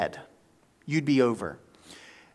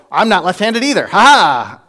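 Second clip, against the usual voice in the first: gloating retort; 6 semitones higher, 10 dB louder than usual. Retold or imitated.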